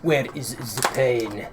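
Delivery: put-on slow, heavy Eastern European accent. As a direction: lean hard into a thick Eastern European accent. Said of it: "the most heavy Eastern European accent".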